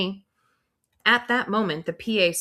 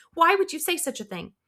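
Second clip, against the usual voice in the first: high, indignant question; protesting; 11 semitones higher than usual. Acted. Miss Fountain protesting, high.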